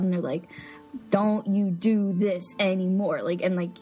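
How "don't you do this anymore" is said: slow, stern Italian grandmother; slow and emphatic. Retold or imitated. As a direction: spell it out slowly so it sinks in. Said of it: "say things really slowly so it gets in their head".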